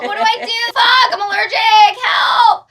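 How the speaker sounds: hammed-up allergic meltdown; mock panic. Retelling her allergic panic to friends, yelling. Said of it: "And I'm like".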